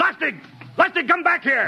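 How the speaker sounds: high, urgent call after a running man; calling after him. Acted. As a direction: call him back, alarmed. Captain calls after running crewman.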